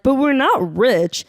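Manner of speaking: imitated younger self insisting; mock naive protest. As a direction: protest "not rich" high and drawn out. Mimicking own younger naive protest.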